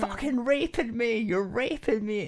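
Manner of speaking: imitated screaming. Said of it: "screaming at the top of her lungs".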